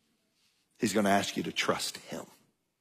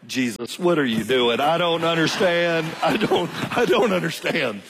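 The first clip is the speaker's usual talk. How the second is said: bewildered prayer; 5 semitones higher, 3 dB louder than usual. Retold or imitated. Praying in bewilderment.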